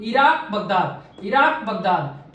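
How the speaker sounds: very slow, spaced-out recitation of each name; slow rote drill. Shown as a demonstration. Teacher to students, slow memorising chant. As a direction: spell it out slowly so students memorise it.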